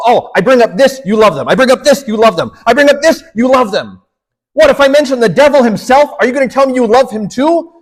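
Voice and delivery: mock-irritated bishop pressing his point; annoyed bishop. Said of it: "The bishop's getting annoyed".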